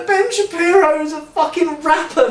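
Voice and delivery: laughing disbelief. Real, not acted. Realizing the final song's rapper, laughing high in disbelief. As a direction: crack up in disbelief.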